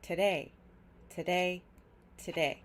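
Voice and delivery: "today" as a quick "tuh" and a strong "day"; reduced first syllable. Shown as a demonstration. Teacher drilling today for learners, first syllable reduced. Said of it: "That two is reduced to just t".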